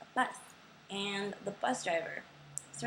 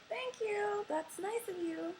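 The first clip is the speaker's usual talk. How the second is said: very high, slow, sugary politeness; fake polite; 13 semitones higher, 3 dB quieter, slower than usual. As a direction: put on a high, slow fake-sweet thank you. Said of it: "And I was like".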